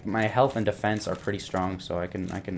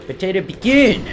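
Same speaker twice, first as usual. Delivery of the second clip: 1 mock battle cry; 8 semitones higher, 9 dB louder than usual. Shouting at enemy in game.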